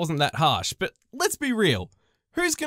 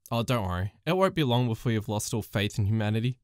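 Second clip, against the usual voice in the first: a much lower, deadpan co-worker; jaded co-worker; 13 semitones lower than usual. Retold or imitated. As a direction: drop the voice low and world-weary. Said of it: "And she goes to me".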